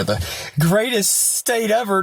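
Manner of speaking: slow, high-pitched mock praise; sarcastic praise. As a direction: draw it out with sarcastic grandeur. Sarcastic praise of home state.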